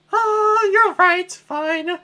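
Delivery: high falsetto princess complaining. Acted. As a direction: squeal like a spoiled princess. Peach voice.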